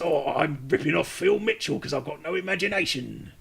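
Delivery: Cockney geezer impression; lightly voiced Cockney geezer; Cockney accent. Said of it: "He's a more deep voice".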